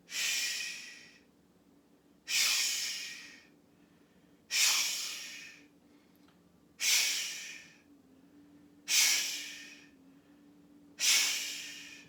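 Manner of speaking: strong liver sound shh; forceful shh. Shown as a demonstration. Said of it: "put a little bit more force to it".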